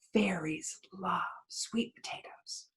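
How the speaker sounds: soft unvoiced whisper to the audience; confiding whisper. Real, not acted. Storyteller to the listeners, a hushed secret aside. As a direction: let them in on a secret.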